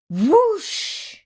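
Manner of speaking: high rushing whoosh; whoosh sound. A narrator's reading. Chick fired from the cannon, a rising whoosh. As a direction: sweep the sound upward.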